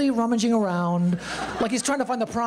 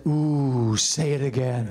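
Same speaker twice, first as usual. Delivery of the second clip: mock seductive; 6 semitones lower, much slower than usual. Teasing reply to nurse.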